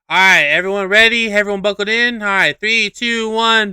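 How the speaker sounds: imitated announcer revving up riders; ride operator. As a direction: call out brightly to a loaded train. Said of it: "this guy goes like".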